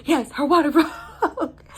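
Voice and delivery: mock his panic while laughing; imitating frantic boyfriend. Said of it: "he's just like".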